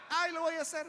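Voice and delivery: high, sing-song excuse; mock procrastinator's brush-off; putting it off. Preacher mimicking believers who postpone things, high brush-off.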